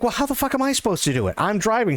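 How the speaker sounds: high, fast, indignant; exasperated protest. Retold or imitated. Protesting to Joy about driving, high fast indignant. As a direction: fire it back high and fast, incredulous.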